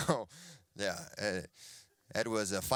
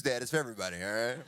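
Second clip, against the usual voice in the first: ordinary talk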